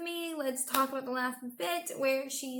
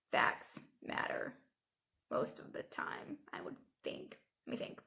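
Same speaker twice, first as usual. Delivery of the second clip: hushed; 8 dB quieter than usual. Real, not acted. Musing on facts mattering, hushed.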